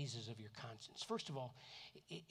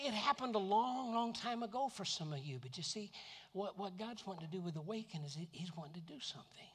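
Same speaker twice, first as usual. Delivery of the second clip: ordinary talk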